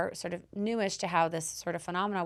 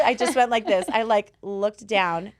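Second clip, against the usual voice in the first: ordinary delivery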